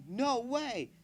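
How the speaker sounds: high, loud shout of disbelief. Acted. Narrator voices the shout heard from the stand, high and loud. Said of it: "We heard her shout".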